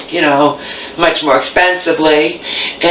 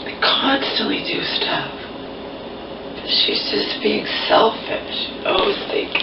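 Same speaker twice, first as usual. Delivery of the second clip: soft and slow; 5 semitones higher, 9 dB quieter, slower than usual. Real, not acted. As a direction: say it under the breath. Venting about mom.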